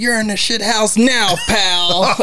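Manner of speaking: high, taunting imitation; hammed-up scornful warning; mock menace. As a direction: jab it at him like a threat. Quoting a threatening line.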